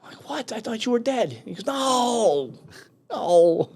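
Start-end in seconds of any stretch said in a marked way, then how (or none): 0.3-1.4 high-pitched retold disbelief; astonished. Marine to the friend he believed dead, astonished. 1.7-3.7 high, stretched mock protest; drawn-out denial. Frank denying to his friend that he died, drawn-out.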